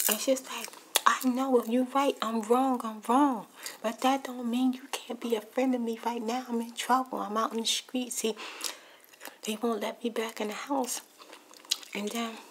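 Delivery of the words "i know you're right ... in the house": loud, high admission turning to a plea; retold urgent begging at high volume; imitated desperate pleading. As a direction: act out her frantic appeal, voice raised. Acting out the desperate phone argument.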